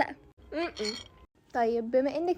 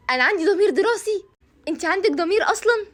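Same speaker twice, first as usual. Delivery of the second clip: scoffing disbelief; 5 semitones higher, 4 dB louder than usual. Acted. Mocking disbelief at study conscience.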